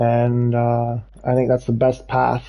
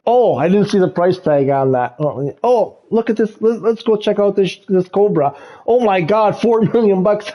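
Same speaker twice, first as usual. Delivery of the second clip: mock player comment; 6 semitones higher than usual. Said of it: "That is gonna be the most repeated comment".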